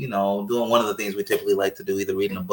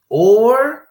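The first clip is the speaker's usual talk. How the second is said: sudden high squeak; 11 semitones higher, 6 dB louder than usual. Real, not acted. Yelping the dreaded alternative. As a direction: jump way up on the word.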